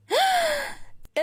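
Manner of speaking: high thrilled gasp before shouting; excited gasp. A narrator's reading. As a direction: gasp like she just spotted a celebrity. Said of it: "صرخت البنت". (شهقة عالية بحماس)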